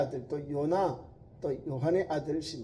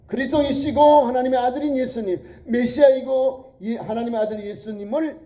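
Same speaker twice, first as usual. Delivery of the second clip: preaching at full force; 9 semitones higher, 13 dB louder than usual. Acted.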